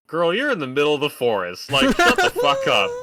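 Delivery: loud, high mock yell at a clueless character; exasperated scolding. Host telling off the lost movie girl, exasperated yell. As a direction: yell it in mock outrage, pitch leaping up.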